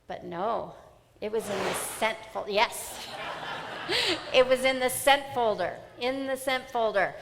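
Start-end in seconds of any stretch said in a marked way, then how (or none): 3.1-4.3 high rueful burst of laughter. Real, not acted. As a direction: laugh at your own panic. Laughing at herself.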